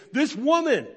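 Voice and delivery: a hint of an offended Sarah; indignant Sarah. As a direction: spit out the words like a jealous wife. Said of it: "Sarah comes to her and she goes".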